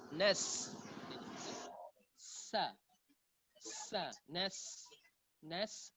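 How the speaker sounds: "Nas" with a long whistling s; soft, isolated "Sa" with sustained hiss; sofir on sin. Shown as a demonstration. Teacher demonstrates the letter sin, whistling s. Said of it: "Sofir itu suara merdu yang keluar".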